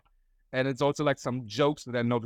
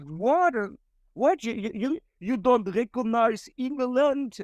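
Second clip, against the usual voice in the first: high, stammering mock astonishment; put-on disbelief, voice shooting up; shocked disbelief; 9 semitones higher, slower than usual. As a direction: imitate a scandalised, incredulous girl. Retold disbelief at not knowing flag.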